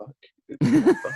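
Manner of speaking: mock shocked reaction. Pub crowd hears the shocking sample, mock shocked curse. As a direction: act out a pub-goer's startled gasp. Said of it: "people can hear the sample ... and go".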